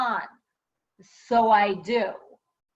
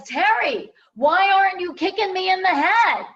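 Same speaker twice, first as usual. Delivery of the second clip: high, loud, urgent demand; 7 semitones higher, 7 dB louder than usual. Retold or imitated. Client pops up demanding she kick him, loudly. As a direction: bursts up and insists loudly.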